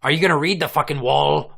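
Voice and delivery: normal talking voice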